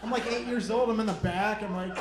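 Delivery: normal talking voice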